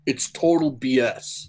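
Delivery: put-on slow New York accent. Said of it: "with a New York accent".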